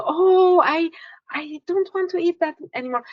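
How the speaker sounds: high, quick surprised client voice; client's sudden realization. Retold or imitated. Coach voicing a client who suddenly rejects food, high and quick. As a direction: voice the client's aha moment, quick and bright.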